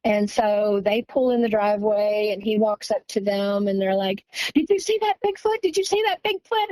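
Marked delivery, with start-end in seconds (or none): 4.5-6.7 excited. Retold or imitated. Sisters eagerly asking the man.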